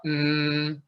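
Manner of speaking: "mm" hummed with lips sealed; lips-shut hum; closed-mouth mm. Shown as a demonstration. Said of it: "and close your mouth".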